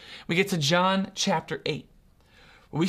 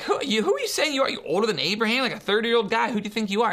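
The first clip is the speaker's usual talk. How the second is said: higher, rushed, sputtering questions; incredulous challenge; 4 semitones higher, much faster than usual. Acted. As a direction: fire off disbelieving questions as the sceptics. Objectors challenge Jesus, acted quote.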